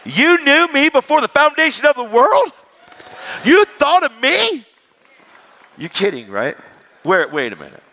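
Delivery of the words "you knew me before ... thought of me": much higher, louder, slowing on the last question; overwhelmed wonder, almost crying out; amazed disbelief. Retold or imitated. Preacher voicing awe at being known by God, astonished.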